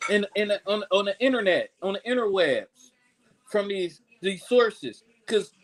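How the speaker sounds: usual speaking voice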